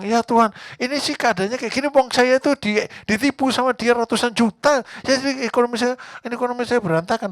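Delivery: high-pitched mock complainer; whining believer. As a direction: whine like someone pleading their excuses. Congregant whining to God.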